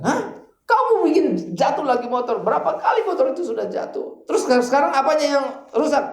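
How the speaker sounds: scolding parent. Retold or imitated. Uncaring parent scolds child.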